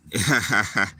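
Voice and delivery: brief voiced chuckle; short laugh. Real, not acted. Streamer laughing off a viewer's jail insult, short chuckle. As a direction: laugh it off in a quick burst.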